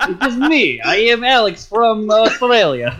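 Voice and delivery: high, slow mock Australian accent. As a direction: ham up an Aussie bloke, laughing. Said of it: "I also do very good Australian accent".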